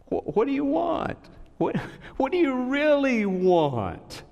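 high, pressing preacher's question; preaching. Acted. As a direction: drive the question home to the church. Preaches to congregation.

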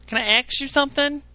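high, whiny caller voice; high mock caller voice, put on for laughs; mocking a dumb caller. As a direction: mimic a clueless caller begging to ask. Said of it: "You know".

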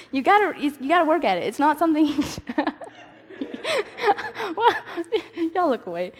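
high, giggling through the words; can't hold the laugh in; cracking up. Real, not acted. Preacher losing it in front of the group, laughing. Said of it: "until y'all started laughing".

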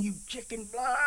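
high, strained mock taunt; taunting God. A man taunting God while walking through sleet, high jeering. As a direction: jeer at heaven. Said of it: "I'm cursing God".

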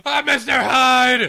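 slow booming Mister Hyde shout; monster roar. Pretend. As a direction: bellow it like a hulking monster. Acting out busting from lab coat.

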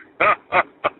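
big booming sidekick guffaw; hearty talk-show sidekick laugh; Ed McMahon laugh. Retold or imitated. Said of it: "So here's the Ed McMahon laugh".

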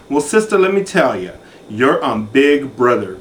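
normal talking voice

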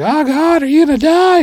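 very high, loud mock panic; hammed-up shriek of alarm; freaking out. Family reacting to the bleeding cut, freaking out. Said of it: "on edge and freaking out".

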